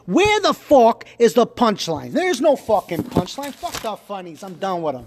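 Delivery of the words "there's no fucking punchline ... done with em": ordinary delivery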